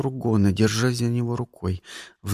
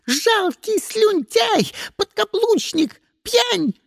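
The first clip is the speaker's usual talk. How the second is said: very high, drawn-out insults; shrieking; much slower than usual. A narrator's reading. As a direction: screech contempt at him. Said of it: "завизжала". (презрительно визжит)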